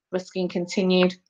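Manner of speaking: ordinary delivery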